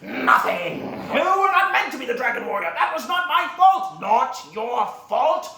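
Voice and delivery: high, drawn-out theatrical shouting; loud, slow, hammering each accusation; furious quarrel. Acted. Villain and master argue over destiny, furious.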